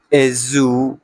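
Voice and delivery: usual speaking voice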